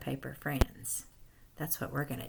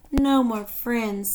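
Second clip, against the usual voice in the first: slow, drawn-out forlorn line; slow storybook lament; sad; 8 semitones higher, 11 dB louder, slower than usual. Acted. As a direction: let it sink in with disappointment. Lissy loses her friends.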